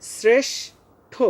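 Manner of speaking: শ্রেষ্ঠ very slow, s first, then sh; syllable-by-syllable demo. Shown as a demonstration. Teacher drills শ্রেষ্ঠ with pupils, slow careful articulation. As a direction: stretch শ্রেষ্ঠ in two high, drawn-out parts. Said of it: "প্রথমে এস, পরে এসএইচ".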